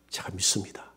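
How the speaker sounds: breathless whispered confession. Retold or imitated. Pastor answering God in the vision, whispered. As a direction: whisper it like a secret.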